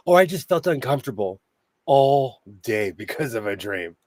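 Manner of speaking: normal talking voice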